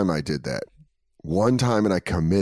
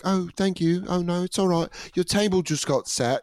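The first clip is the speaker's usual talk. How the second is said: higher, fake posh British hostess; fake British accent; 7 semitones higher than usual. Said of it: "did a British accent".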